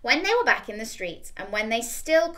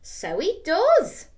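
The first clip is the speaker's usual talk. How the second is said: high, slow, louder; little skeleton; 8 semitones higher, 6 dB louder, much slower than usual. Acted. Little skeleton agrees, high and slow. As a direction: agree slowly.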